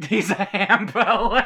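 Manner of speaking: giggling through the words; laughing, slow and delighted. Real, not acted. Laughing along at the pun.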